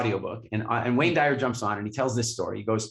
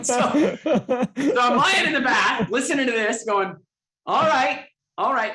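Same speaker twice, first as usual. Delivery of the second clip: laughing; 10 semitones higher, 5 dB louder, slower than usual. Real, not acted. Laughing while telling.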